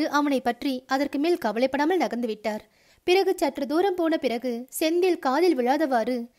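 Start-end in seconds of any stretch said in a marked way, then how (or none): none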